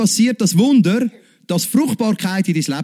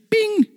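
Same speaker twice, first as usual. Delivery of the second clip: a sudden high bing; imitated light-switch bing. Preacher to congregation, mimicking a light clicking on. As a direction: pop the sound of a light going on.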